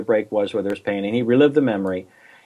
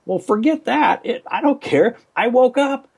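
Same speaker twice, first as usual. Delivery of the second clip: exasperated outburst; 12 semitones higher than usual. Retold or imitated. Retold own exasperated reaction.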